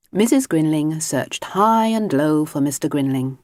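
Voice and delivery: usual speaking voice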